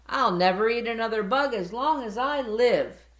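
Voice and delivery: loud, high happy cheer. Acted. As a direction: cheer it with a full belly. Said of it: "cheered Stella Luna".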